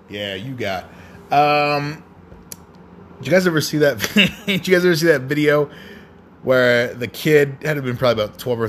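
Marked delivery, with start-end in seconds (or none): none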